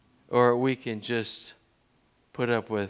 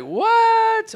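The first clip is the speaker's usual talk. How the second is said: a sudden, much higher and louder disbelieving what; incredulous squeak of surprise; stunned what; 10 dB louder than usual. Retold or imitated. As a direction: jump up in total disbelief. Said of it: "you're like".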